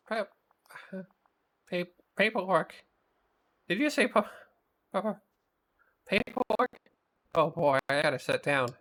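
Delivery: high, stuttering panic; panicked stammering monster. Acted. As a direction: stammer in horror at the word. Mike panics about paperwork.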